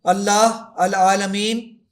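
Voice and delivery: very slow and loud, with العالمین deliberately recited wrong; a demo misreading for the recitation checker; one word misrecited. Shown as a demonstration. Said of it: "یہ غلط پڑھوں گا".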